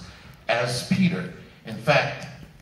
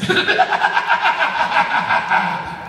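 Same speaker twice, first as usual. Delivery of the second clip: laugh; 7 dB louder than usual. Real, not acted. Laughing after the demo.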